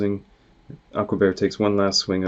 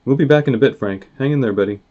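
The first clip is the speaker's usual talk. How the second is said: called out loud to a friend up a tree; raised, encouraging call; 5 semitones higher, 7 dB louder, faster than usual. Acted. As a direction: shout back over the distance. Heroes calling to Frank in tree.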